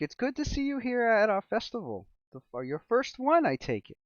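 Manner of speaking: a hint of a chirpy village baker; lightly voiced cheerful baker.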